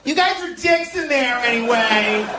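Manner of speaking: high-pitched, hammed-up Mexican protester; plead, then curse, way up high; cartoonish high Mexican voice, stretched-out cries; Mexican at the border. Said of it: "this is a Mexican at the border".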